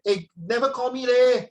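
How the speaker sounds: high Singlish whine with loud "leh"; imitated Singlish. Foreign colleague in Shenton Way complaining, high-pitched imitated Singlish. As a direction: mimic a foreigner gone fully Singlish. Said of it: "the Amor also talk like that".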